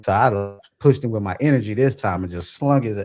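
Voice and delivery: ordinary talk, nothing marked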